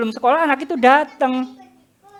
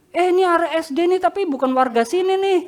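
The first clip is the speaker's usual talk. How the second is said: curious bystanders; 7 semitones higher than usual. Retold or imitated. Bystanders asking who the boy is.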